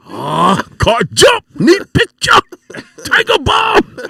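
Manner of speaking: put-on very high, loud accented shouts; imitated gambler's yell. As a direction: cry out like excited gamblers calling cards. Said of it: "so that was the thing, like".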